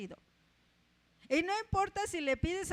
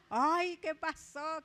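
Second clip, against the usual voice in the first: mock alarm; 2 semitones higher, 3 dB louder, much slower than usual. Mocks the listeners' guess.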